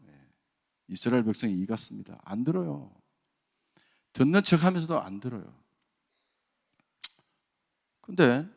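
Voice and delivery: ordinary talk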